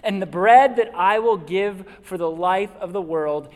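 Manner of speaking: proclaiming Jesus' words to the congregation at full volume; full-force preaching. Acted. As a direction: preach it out with conviction.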